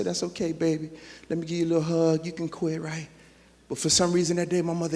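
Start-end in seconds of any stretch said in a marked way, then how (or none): 0.0-3.1 imagined tender mom. Retold or imitated. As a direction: soothe the child gently. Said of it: "put her arm around me and say".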